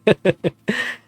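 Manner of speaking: a short high embarrassed giggle; quick giggle. Real, not acted. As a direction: giggle under your breath. Flustered at a free show.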